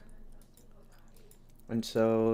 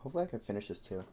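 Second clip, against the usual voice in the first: talking through a mouthful of food; words mumbled round a bite; chewing while talking; 7 dB quieter, much faster than usual. Real, not acted. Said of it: "excuse the chewing".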